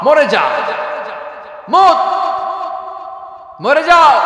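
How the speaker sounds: sermon shout of doom; commanding shout. Acted. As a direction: bellow the order. Preacher voices command to die.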